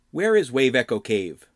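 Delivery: lightly voiced stern questioner; interrogating Lee.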